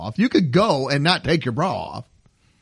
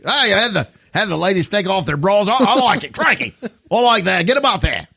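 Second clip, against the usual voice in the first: mock Australian accent; 5 semitones higher than usual. Crocodile Dundee character approving from the back office, mock Australian accent. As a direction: ham it up in broad, excited Australian. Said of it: "Crocodile Dundee back in the office".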